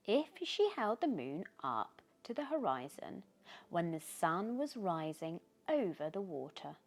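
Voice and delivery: usual speaking voice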